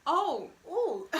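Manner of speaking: high, loud delighted "ooh" into a laugh; light up with glee and laugh; thrilled squeal breaking into laughter; excited laugh. Real, not acted. Finds topic she wanted.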